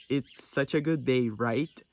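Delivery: "It's such a good day, right?" said slowly, rising on "right"; a slow American line with a rising "right?" tag. Shown as a demonstration. Teacher showing students the American version, slow with a rising right. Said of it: "Eles já usam mais o "right".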